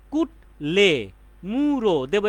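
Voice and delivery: "কুটলে" slowly split as কুট, then লে; broken into syllables. Shown as a demonstration. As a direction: stretch the syllables of "মুড়ো" after a split "কুটলে". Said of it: "বা যতটুকু ভাঙা যায়". ("কুটলে মুড়ো" অক্ষর ভেঙে ধীরে ধীরে)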